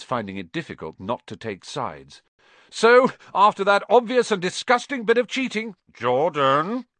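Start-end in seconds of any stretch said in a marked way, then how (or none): 2.7-5.8 high, loud indignant commentator; sports announcer outraged at a foul; excited commentator. Acted. 5.9-6.9 growled warning. Acted. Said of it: "growled Professor McGonagall".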